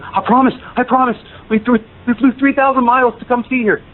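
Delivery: high, urgent retold begging; excited pleading. Fan promising the seller not to resell, excitedly pleading.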